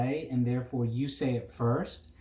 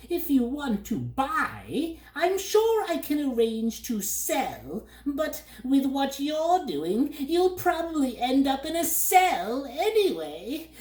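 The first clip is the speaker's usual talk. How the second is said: sly bird; 3 dB louder than usual. A narrator's reading. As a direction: tease him like a sly trader bird. Bird figure.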